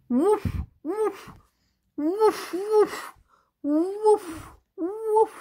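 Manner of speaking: high, loud imitated barking; playful dog woof. Storyteller showing children what a dog says, high playful woofs. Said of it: "A dog says".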